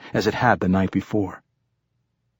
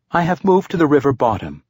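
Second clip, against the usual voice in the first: lightly voiced ship's computer, pitched higher; 5 semitones higher, 4 dB louder than usual. A narrator's reading. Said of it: "said the ship".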